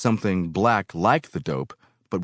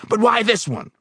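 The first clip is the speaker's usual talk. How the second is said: angry demand; 6 dB louder than usual. Acted. Foreman furiously demanding who gave him the liquid. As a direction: grill him hard.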